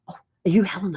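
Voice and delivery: a hushed whisper. Retold or imitated. Motel staff whisper a greeting to Helen at the door. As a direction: whisper it so nobody hears. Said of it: "they're like".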